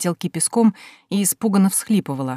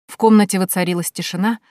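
plain reading voice

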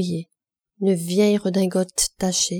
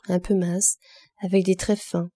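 plain reading voice

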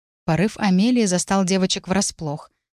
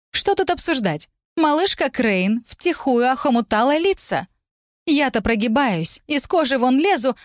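higher, bitter jealous rant; jealous tirade; 7 semitones higher than usual. Acted. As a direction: spit out a jealous outburst.

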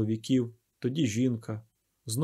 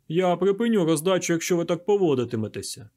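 loud, higher-pitched warning; raised voice laying down an ultimatum; threat; 5 semitones higher, 5 dB louder than usual. A narrator's reading. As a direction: threaten the unruly crowd sternly. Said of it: "Погроза справила враження".

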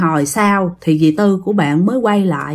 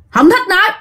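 a much higher, clipped refusal in an eerie put-on voice; possessed man's curt refusal; 10 semitones higher than usual. Possessed man refuses the medium, high and curt. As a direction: snap it out like a stranger inside him. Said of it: "ổng nói".

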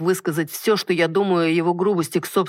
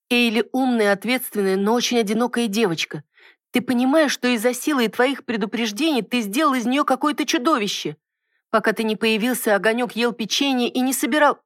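indignant; 8 semitones higher than usual. A narrator's reading. Wife indignantly scolds husband.

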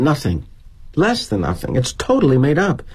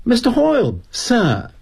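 put-on higher-pitched young Brit addressing the boss; British kid; 12 semitones higher than usual. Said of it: "This Brit kid who works for us".

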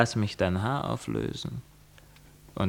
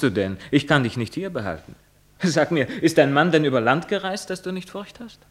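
high, loud, sneering question; mock her, pitch rising; 7 semitones higher, 6 dB louder than usual. Lover mocking the bride, high and loud.

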